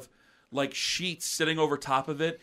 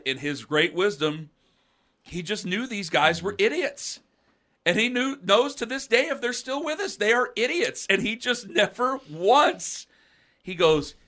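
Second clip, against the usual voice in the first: ordinary talk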